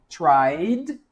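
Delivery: "tried" ending in a clear voiced D. Shown as a demonstration. Teacher shows final D in tried. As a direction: end "tried" on a D sound. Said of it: "som de D, ó".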